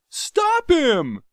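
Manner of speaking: high, loud panicked yell; frantic scream. Acted. Jav screaming at Carthoris to stop Tario. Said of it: "he screamed".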